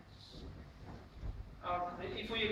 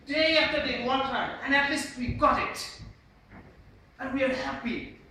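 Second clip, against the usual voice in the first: loud, raised pitch, hammering each phrase; emphatic conviction; 5 semitones higher, 10 dB louder than usual. Shown as a demonstration. Trainer shows the class an emphatic gesture, loud and forceful. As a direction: proclaim it proudly, without any doubt. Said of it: "say something without any doubt".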